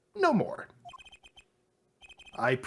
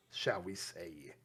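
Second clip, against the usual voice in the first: slow secretive whisper; 12 semitones lower, 14 dB quieter, slower than usual. Acted. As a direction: lean in and whisper it. Conspiratorial aside.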